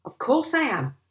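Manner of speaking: high, loud angry retort; exasperated outburst; indignant cry. A narrator's reading. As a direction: cry out impatiently. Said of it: "cried Mister Hazel".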